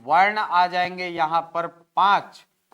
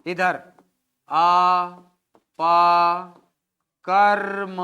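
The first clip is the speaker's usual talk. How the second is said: each syllable split and punched loud; 6 dB louder, much slower than usual. Shown as a demonstration. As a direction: break the word into loud separate pieces. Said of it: "अपकर्म को मैं ऐसा लिख सकता हूं क्या". (शब्द को ज़ोर से अलग-अलग टुकड़ों में बोलो)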